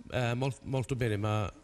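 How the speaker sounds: ordinary talk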